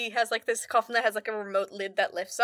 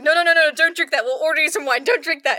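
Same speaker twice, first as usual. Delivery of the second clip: louder, higher urgent "no no no"; alarmed shouting; 5 semitones higher, 8 dB louder than usual. Retold or imitated. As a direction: stop her before she drinks. Vampires stopping her drinking blood.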